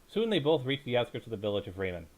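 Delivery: usual speaking voice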